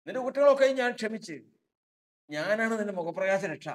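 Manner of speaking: high, loud and fast proclamation; proclaiming forgiveness. Acted. Preacher voices God declaring forgiveness, loud and high. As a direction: announce forgiveness with full force. God's words rising to proclamation.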